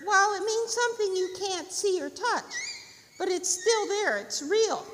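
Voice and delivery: high, loud bird voice; earnest bird. Acted. Bird explaining spirit to squirrel, high and earnest. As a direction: pipe up and insist.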